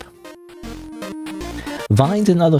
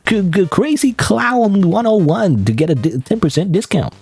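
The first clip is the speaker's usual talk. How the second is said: higher, breezy vlogger patter; influencer voice; 7 semitones higher than usual. Retold or imitated. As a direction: hype up the product. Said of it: "that's what an influencer would sound like".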